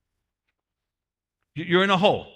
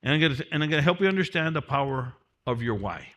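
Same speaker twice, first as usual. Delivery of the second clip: ordinary talk, nothing marked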